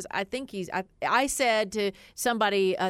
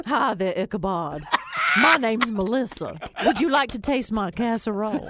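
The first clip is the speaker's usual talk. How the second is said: flirty Southern neighbor; 6 dB louder than usual. Pretend. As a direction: play the old Southern lady offering casserole. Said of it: "how would you go introduce yourself".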